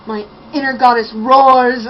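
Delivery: loud, hammed-up roar of a quote; mock roar. Reviewer mocking her favorite inner goddess line, roaring. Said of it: "The roaring inner goddess".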